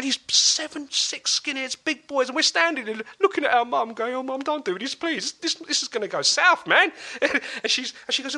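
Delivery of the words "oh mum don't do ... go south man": much higher, worried plea; anxious begging. Retold or imitated. Boys beg mum not to start a fight, high and anxious. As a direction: beg her to back down before it goes wrong.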